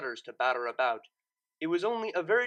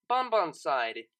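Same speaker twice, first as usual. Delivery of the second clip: usual speaking voice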